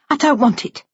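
high-pitched, curt rejection; hurt daughter turning it down; angry refusal. Acted. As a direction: refuse the gift, pitched well up. Daughter refuses her father's present.

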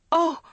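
sharp high gasp of fright; frightened gasp. Acted. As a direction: gasp in sudden fear. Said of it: "she gasped".

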